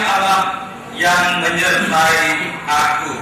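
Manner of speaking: loud, high-pitched preacher's proclamation; ringing pulpit declamation; full-force scripture preaching. Real, not acted. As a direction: proclaim the verse at full volume, drawing out key phrases.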